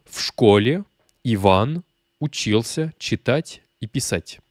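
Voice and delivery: slow, word-by-word Russian model reading; slow for repetition. Shown as a demonstration. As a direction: read it slowly, pausing so learners can repeat. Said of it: "à répéter avec moi".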